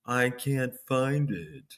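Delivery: slow, softened mimicked accent; K K's fake accent. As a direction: draw out her fake accent slowly. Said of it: "She drives me nuts with that one".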